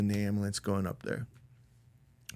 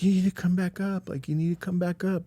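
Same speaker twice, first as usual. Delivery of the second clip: higher-pitched imitation of distraught urgency; urgent, raised-pitch plea, mildly acted; hysterical pleading; 8 semitones higher than usual. Sarah phones him to come back, imitated hysterical pleading. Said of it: "crying hysterically".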